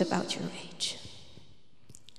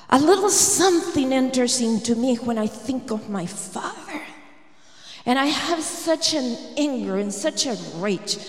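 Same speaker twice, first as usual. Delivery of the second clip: louder, building retold rage; rising anger; 3 semitones higher, 5 dB louder than usual. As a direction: let the boy's rage build as he speaks. Boy voiced rising in rage.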